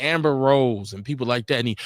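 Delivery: normal talking voice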